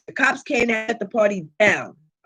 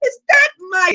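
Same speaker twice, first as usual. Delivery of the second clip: high, loud stunned cry; shocked double take; 6 semitones higher, 4 dB louder, slower than usual. Retold or imitated. Spotting her divine masculine on the corner, shocked. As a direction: gasp it as you spot him. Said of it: "And I'm looking like".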